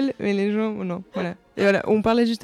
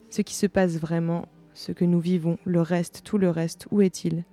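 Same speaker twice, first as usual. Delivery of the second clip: calm, measured literary reading; reading aloud; 3 semitones lower than usual. Acted. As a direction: read it like a thoughtful essay. Reading a Perec essay aloud.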